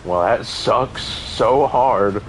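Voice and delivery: slow, drawn-out and loud, overly dramatic; mock resigned groan. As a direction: drag it out like a sarcastic sigh. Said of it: "I was just like".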